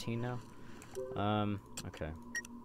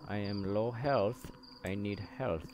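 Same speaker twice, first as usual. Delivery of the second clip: normal talking voice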